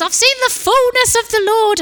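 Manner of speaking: high, loud ecstatic shout. Retold or imitated. Young man in the car shouting his revelation, high ecstatic shout. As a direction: bellow it out like a man overjoyed. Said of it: "still shouting at the top of his lungs".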